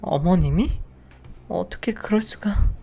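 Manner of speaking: high, slow, breathless whisper; stunned whisper. Acted. Wife learning mother-in-law set it up, stunned whisper. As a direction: whisper it as the floor drops away.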